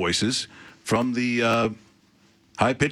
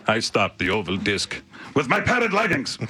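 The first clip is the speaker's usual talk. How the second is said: lightly hammed Finnish goalie impression; cartoonish goalie bragging; Kipper character voice. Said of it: "that was sort of a character voice".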